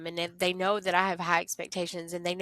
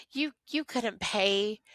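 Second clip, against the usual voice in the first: usual speaking voice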